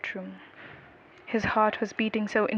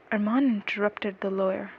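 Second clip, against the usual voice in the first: plain reading voice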